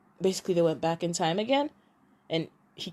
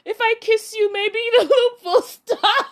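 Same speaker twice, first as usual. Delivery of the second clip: high, laughing offer; mock flirt; 14 semitones higher, 8 dB louder than usual. Heroine offers a kiss to stop the loop, high and giggly. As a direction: tease it up high through giggles. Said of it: "she's like".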